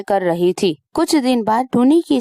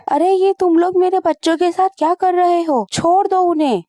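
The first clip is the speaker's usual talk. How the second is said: much higher desperate shout of a mother; frantic cry; 9 semitones higher than usual. Acted. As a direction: cry out high to save the children. Mother sees children on stove. (बच्चों को बचाने के लिए ऊँची, घबराई पुकार)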